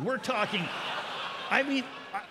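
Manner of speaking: loud, high, laughing exaggeration; over-the-top comic buildup; excited build. Real, not acted. Comic exaggeration of huge seatmate.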